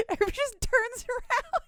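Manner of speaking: high, squeaky laughing voice; cracking up while talking; helpless laughter. Real, not acted. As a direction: squeeze the words out through real giggles. Cracking up at the absurd scene.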